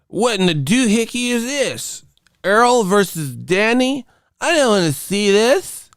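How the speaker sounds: high-pitched tantrum, hammed up; mock old-media complainer. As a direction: parody a fussy objector in a silly high voice.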